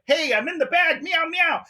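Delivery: high squeaky cat voice; sarcastic cat in a bag; mock cat voice. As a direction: play the trapped cat calling out. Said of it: "not a single meow, like".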